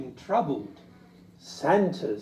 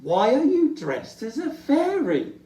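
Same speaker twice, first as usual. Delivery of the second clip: higher, louder puzzled Rabbit; Rabbit's voice; 9 semitones higher, 6 dB louder than usual. Acted. Rabbit asking Little Bear, surprised. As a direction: question him with surprise. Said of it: "said Rabbit".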